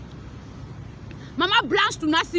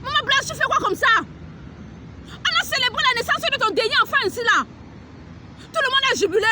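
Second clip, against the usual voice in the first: rising rebuke; 7 semitones higher than usual. Real, not acted. Prophetess accusing the pastor's wife, high and heated. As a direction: press her hard, voice climbing.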